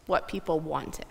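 ordinary talk, nothing marked

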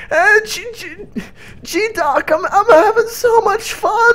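hammed-up weepy joy; mock crying. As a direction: blubber it through fake tears. Fake-crying high voice.

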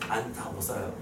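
low, quiet mock grumble; complaining old-timers. Teacher imitating elders who claim they can't go on, grumbling. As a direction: imitate elders moaning, voice sinking away. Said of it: "어르신들".

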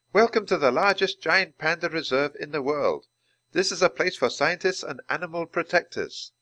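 a hint of a polite guide addressing the group; lightly voiced Dr. Ling. A narrator's reading.

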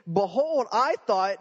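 sarcastic frustration. Acted. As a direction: sneer it, fed up. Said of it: "hear the sarcasm and frustration".

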